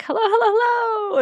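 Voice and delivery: high, sing-song hellos; excited greeting. Retold or imitated. Emily greeting Lorelai and Rory at the door, excited. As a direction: greet them with bright delight. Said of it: "very excited to see them".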